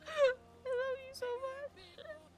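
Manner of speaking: high, tearful, breaking into sobs; fighting back tears while thanking; crying on stream. Real, not acted. Streamer tearfully thanking her dying companion, real crying. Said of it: "I'm gonna cry on stream".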